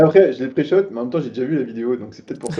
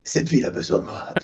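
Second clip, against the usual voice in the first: vigilante; 3 semitones lower, 6 dB quieter than usual. Pretend. Vigilante line, whispered with laughter.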